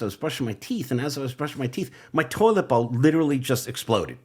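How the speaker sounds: normal talking voice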